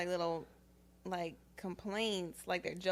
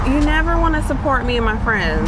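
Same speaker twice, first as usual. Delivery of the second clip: loud, hurt accusation; skit girlfriend; 4 semitones higher, 20 dB louder than usual. Acted. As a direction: throw the complaint back at him.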